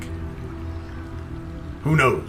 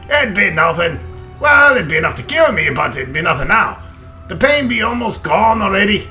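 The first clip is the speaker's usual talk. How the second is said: loud, higher hearty dwarf; 5 semitones higher, 5 dB louder than usual. Acted. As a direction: boom it out like an old dwarf. Reads dwarf lines in character.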